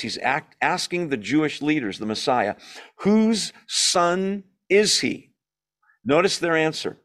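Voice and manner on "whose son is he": higher, very slow, each word weighted; slow emphatic question. Acted. Preacher voicing Jesus' question to the leaders, slow and high. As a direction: lean hard into every word.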